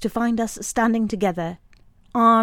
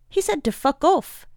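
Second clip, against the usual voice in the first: defiant; 8 semitones higher, 3 dB louder than usual. Acted. Fritz repeats the curse.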